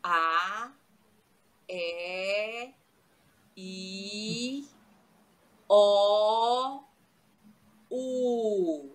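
long, drawn-out single vowels; vowel demonstration. Shown as a demonstration. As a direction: hold each vowel, separated by pauses. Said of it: "Vamos lá".

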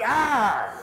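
Pennsylvania Dutch shout. Retold or imitated. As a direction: shout it at the new guy in a thick Pennsylvania Dutch accent. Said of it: "they talk funny and they shout".

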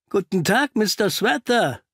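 bright, cheery greeting to the caught man; cheerful call. A narrator's reading. Holmes cheerfully greets the trapped intruder, delighted call. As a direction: hail him gleefully like an old friend. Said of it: "rief Holmes fröhlich".

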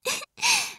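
short rising giggle; amused giggle. Acted. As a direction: giggle fondly. Giggle at sleepy partner.

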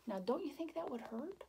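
plain reading voice

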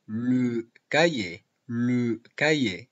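le cahier said slowly, le with a rounded French e. Shown as a demonstration. Teacher drilling le cahier to Spanish students, slow and clear. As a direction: model le cahier slowly for the class. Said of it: "si en francés digo le cahier".

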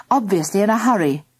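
usual speaking voice

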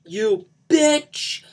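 high, loud venomous scream; spat-out fury. Acted. Johnny curses Lisa while wrecking the house, spitting fury. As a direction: spit the insult out hard. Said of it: "he spat out".

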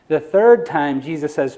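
usual speaking voice

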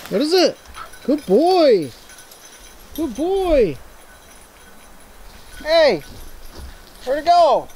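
high, bright dog-praise voice; squeaky praising a dog. Real, not acted. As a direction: talk to the dog like a puppy.

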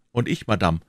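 usual speaking voice

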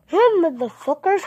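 high loud mock greeting; bratty yelled hello; cheeky yelled greeting. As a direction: shout it to shock. Rude joke vlog greeting.

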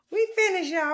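breathless after a walk; panting a little between words; out of breath. Real, not acted. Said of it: "I'm out of breath".

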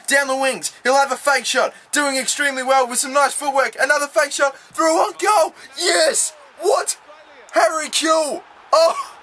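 high-pitched commentary building to a shout; excited goal call. Real, not acted. Commentates own attack building to goal.

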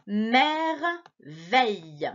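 Merveille stretched very slowly, syllable by syllable. Shown as a demonstration. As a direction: sound out Merveille for a child. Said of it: "essaie de lire avec moi".

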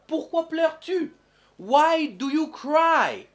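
put-on high, slow, loud question to a crying child; mock concern for a crying child; mock pitying question. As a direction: ask the crying kid why, drawn out.

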